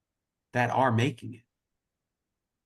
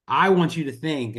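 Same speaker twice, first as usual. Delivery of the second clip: normal talking voice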